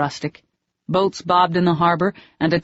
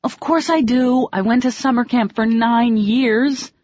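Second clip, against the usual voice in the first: defensive; 7 semitones higher than usual. Acted. Defending herself against his taunt.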